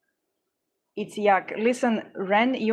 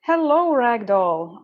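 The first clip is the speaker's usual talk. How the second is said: excited greeting; 3 semitones higher, 6 dB louder, slower than usual. Real, not acted. Greeting a viewer, calling out.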